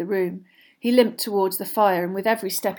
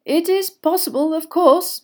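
high, lilting courtesy; politely conceding, voice lifted; 10 semitones higher, 7 dB louder than usual. A narrator's reading. Headmaster conceding a possible mistake, politely. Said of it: "said Dumbledore politely".

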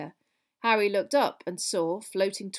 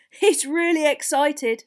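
loud, high, drawn-out enthusiasm; bouncing excitement; 7 semitones higher, 10 dB louder, slower than usual. Acted. Boy about his new-pupil brother, very excited. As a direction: gush like an overexcited little boy. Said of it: "practically bouncing up and down".

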